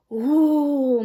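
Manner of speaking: loud breathy gasping ooh; the crowd flinches; whispered anxious ooh; crowd moan. Acted. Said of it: "moaned the crowd".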